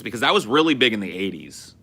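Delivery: ordinary delivery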